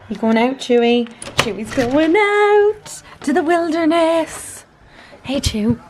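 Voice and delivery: high, drawn-out singsong to a pet; playful dog talk. Real, not acted. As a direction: tease the dog with a big, loud, high flourish.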